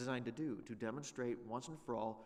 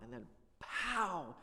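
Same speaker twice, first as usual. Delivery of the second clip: pow; 6 semitones higher, 4 dB louder, much slower than usual. Retold or imitated. Imitating a lightning strike.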